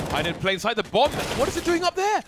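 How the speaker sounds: loud, soaring play-by-play cry; excited shouting. Real, not acted. Caster calling the bomb carrier's hiding spot, excited shouting. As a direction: build to a high-pitched, incredulous yell.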